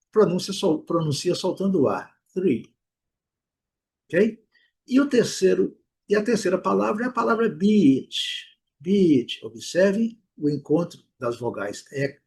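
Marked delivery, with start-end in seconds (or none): 2.3-2.7 three with tongue between teeth; tongue between the teeth on three, releasing air; English th. Shown as a demonstration. Teacher modeling three for students. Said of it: "a língua entre os dentes". 8.8-9.4 beach with a long ee. Shown as a demonstration. Teacher modeling beach for the class. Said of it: "o encontro vai dar esse I longo".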